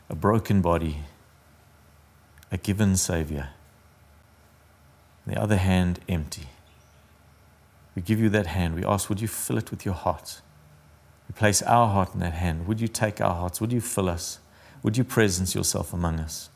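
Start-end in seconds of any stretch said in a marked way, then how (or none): none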